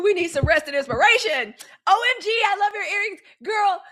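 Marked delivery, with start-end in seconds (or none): 1.9-3.8 excited gushing. Real, not acted. Gushing over earrings brand.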